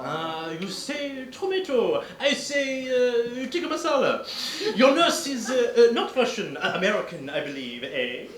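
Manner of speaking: lightly hammed French detective, pleased with himself; French accent. Detective showing off to the countess, French accent. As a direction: lay out the deduction with Gallic flair.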